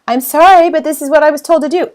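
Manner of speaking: high, loud, hurried excuse; blame-shifting excuse. Shown as a demonstration. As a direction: pass the blame to the boss, defensively. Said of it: "We do not throw other people under the bus".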